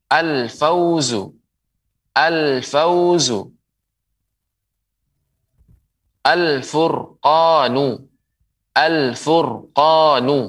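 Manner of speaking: slow "al-fauzu" with the l said clearly; clear al pronounced. Shown as a demonstration. Teacher modelling "al-fauzu" slowly to viewers. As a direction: model "al-furqanu" cleanly, slowly. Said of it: "Dibaca dengan jelas".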